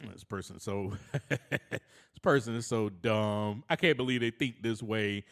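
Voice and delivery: low, dismissive mutter of a judging mind; mocking inner voice. Voicing his judging inner monologue, low and dismissive. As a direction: sneer at the other person inside your head.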